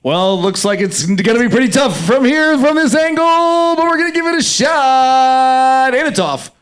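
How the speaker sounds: play-by-play call with words stretched high; sports commentator. Pretend. Playing a commentator for an improv prompt, excited play-by-play. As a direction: draw out the line endings like an excited announcer. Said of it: "Something you can say as a sports commentator".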